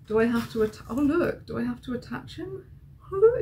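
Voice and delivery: ordinary talk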